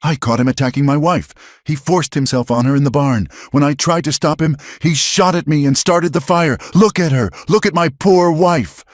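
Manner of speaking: loud high authoritative accusation; loud righteous lie; commanding. A narrator's reading. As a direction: take command of the crowd. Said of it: "his voice regaining its command".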